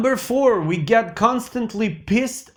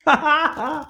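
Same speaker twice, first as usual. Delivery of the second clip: short laugh; 9 semitones higher than usual. Real, not acted. Laughs after street greeting.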